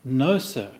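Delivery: usual speaking voice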